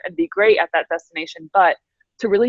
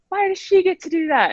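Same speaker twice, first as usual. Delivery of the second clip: high, mock-whiny complaint; pitched-up kid whining; 11 semitones higher than usual. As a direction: imitate the jealous club kids. Club kids complaining, quoted.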